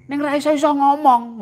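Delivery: high, loud complaint; frustrated exclamation. Acted. Preacher acting his frustration at not speaking English, exclaimed. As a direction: vent the frustration.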